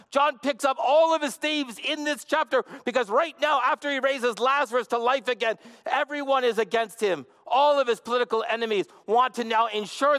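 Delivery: high, driving sermon delivery; impassioned preaching. Real, not acted. Preacher urging the congregation through John's themes, impassioned. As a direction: preach it in a high, building rush of conviction.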